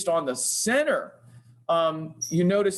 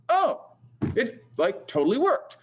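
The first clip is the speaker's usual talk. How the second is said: a sudden, much higher "Oh" of delight that settles into pleased talk; surprised delight; 6 semitones higher than usual. Real, not acted. Demonstrating CAD software, the speaker is surprised it worked, delighted. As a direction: gasp it out as the tool finally works.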